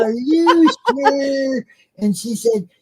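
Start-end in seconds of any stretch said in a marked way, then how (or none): 0.0-1.6 high, drawn-out incredulous question; retold disbelief. Co-host retelling his doubt to the guest, incredulous. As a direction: stretch it out slowly in mock disbelief. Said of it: "I said".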